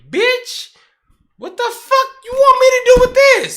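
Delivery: very high, loud squeal of outrage; shrieking disbelief. Retold or imitated. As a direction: ham up the indignation. Retold indignant reply.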